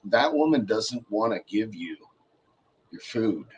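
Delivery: low, quiet relay of bad news; imitated confiding. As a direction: say it low, with pauses.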